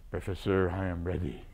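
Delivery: low French-accented imitation; Fouget's French accent. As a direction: answer like an old Frenchman, deep and French-accented. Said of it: "he had a wonderful French accent".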